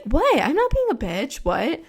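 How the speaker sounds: fake innocent. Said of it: "they could easily be like".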